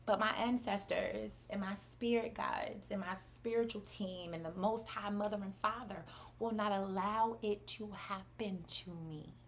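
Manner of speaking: soft, measured declaration of faith; hushed, resolute voice; calm assurance. Real, not acted. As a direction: settle down and state it with quiet certainty. Calm assurance after the rant.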